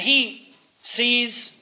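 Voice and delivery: slow, drawn-out "sees" set apart; sees in quotes. Real, not acted. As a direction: pause before "sees", as if putting it in quotes. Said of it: "in, in quotes, he sees".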